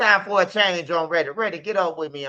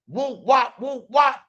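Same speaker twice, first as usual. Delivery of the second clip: punch sound effects; 8 semitones higher, slower than usual. Retold or imitated. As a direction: call out each swing as a sound. Imitating swings in fight story.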